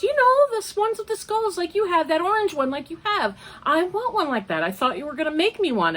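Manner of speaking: high, lightly mocking voice of a pushy friend; voicing the friend. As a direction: play the friend asking for a scarf, pitched high. Friend voiced in retold story.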